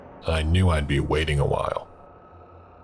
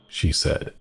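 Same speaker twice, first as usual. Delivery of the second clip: usual speaking voice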